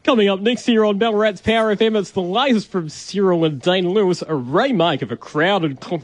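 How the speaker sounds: radio announcer voice. Shown as a demonstration. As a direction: pump it up like breakfast radio. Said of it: "I wanna hear what you sounded like on air".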